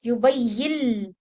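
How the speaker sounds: slow युबय्यिल with nun merged into lam. Shown as a demonstration. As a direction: say "युबय्यिल", ending on l, not "यिन". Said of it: "यिन नहीं है, यिल".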